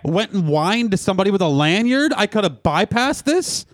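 mock whine. Mocking whiny line cutter.

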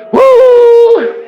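loud high-pitched "woo"; excited whoop. Real, not acted. Preacher thrilled by his own story, big whoop. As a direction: let out a long piercing whoop.

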